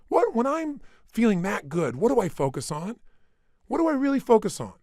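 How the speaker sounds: pumped-up, high voice; acting out feeling good. Shown as a demonstration. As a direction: act out the good state. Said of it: "Let me try it".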